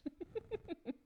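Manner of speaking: high, soft giggle; giggling. Real, not acted. Streamer amused at their own foot cramp, giggling high. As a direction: let a high giggle trail out quietly.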